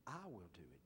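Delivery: soft whisper pressing I; whispered emphasis on I. Shown as a demonstration. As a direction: whisper I will do it, leaning on I. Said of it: "do you notice the emphasis".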